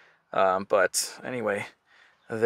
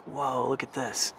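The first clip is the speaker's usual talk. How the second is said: ordinary talk, nothing marked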